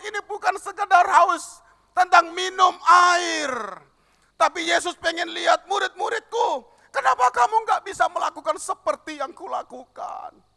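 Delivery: shouting the sermon at the top of the voice; preaching at full force. Acted. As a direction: preach it out high and loud to the congregation. (berkhotbah dengan berteriak penuh semangat)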